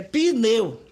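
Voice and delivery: pneu with an added vowel, pe-neu; two-syllable pneu. Shown as a demonstration. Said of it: "Pneu tem duas sílabas".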